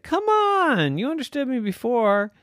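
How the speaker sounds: put-on complaining child's protest; exasperated plea. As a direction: plead in frustration. Boy pleads with unresponsive robot.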